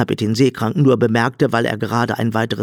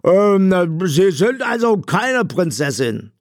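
high, put-on robber voice, puzzled; hesitant high-voiced questioning; blind robber; 9 semitones higher, slower than usual. A narrator's reading. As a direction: ask in a high, odd character voice. Blind robber questions his captive.

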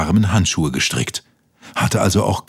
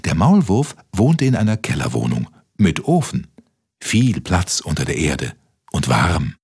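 usual speaking voice

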